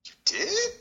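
high, incredulous "You did?"; a squeaky stunned question; disbelief. Retold or imitated. Hearing he watched Terry Funk, high surprised. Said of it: "I'm like".

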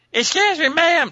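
very high, loud squeaky imitation of Elise. Man mimics wife Elise calling to stranger, high squeaky. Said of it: "you know her squeaky voice".

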